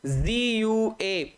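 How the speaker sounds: very slow, stretched-out letters; slow spelling. Shown as a demonstration. Teacher spelling guarantee slowly for students. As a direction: spell it out carefully for the exam. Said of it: "guarantee की spelling भी खूब पूछी जाती है".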